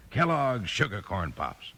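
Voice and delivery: usual speaking voice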